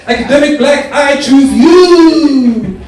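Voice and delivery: high, loud call with a long drawn-out last word; hammed-up cartoon trainer summoning a monster; mock Pokemon battle cry. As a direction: shout it high, stretching the end.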